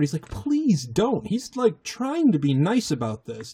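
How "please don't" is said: high-pitched pleading; mock-desperate, high-pitched begging; desperate plea. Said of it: "he gets desperate".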